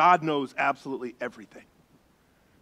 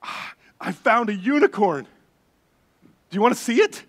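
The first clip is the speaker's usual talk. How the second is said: higher, louder, breaking for excited pauses; giddy little girl; 6 semitones higher, 6 dB louder than usual. Retold or imitated. Preacher imitating his daughter announcing a unicorn, giddy. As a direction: imitate a child bursting with a secret. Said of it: "she was just giddy. She could barely talk".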